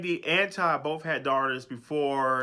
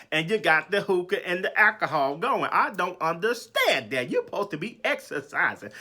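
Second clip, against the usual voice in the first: incredulous, a bit louder and higher, calling her out; exasperated scolding; 4 semitones higher, 4 dB louder than usual. Acted. Speaking to Marlo about drinking while exercising, exasperated. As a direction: scold her like you can't believe it.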